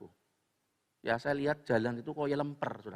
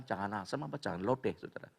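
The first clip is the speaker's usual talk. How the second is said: ordinary delivery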